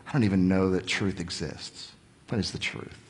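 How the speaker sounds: a doubter's low, soft dismissal; cynical. Retold or imitated. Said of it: "And he has this cynical".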